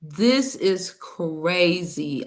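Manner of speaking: very slow, drawn-out disbelief. Real, not acted. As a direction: stretch it out, stunned. Stunned aside after the clip.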